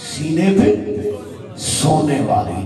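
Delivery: slow drawn-out repeat. Shown as a demonstration. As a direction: stretch سینے پہ سونے والی slowly with a falling end. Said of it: "repeated slower".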